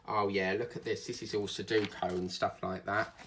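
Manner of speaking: normal talking voice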